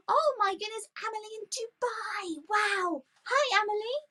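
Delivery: high, thrilled gasp and hello; excited greeting. Real, not acted. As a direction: gush over the viewer. Greeting a viewer from Dubai.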